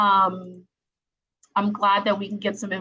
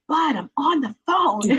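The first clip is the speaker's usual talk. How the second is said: exasperated parent; 6 semitones higher than usual. Retold or imitated. Retelling shushing son during a meeting.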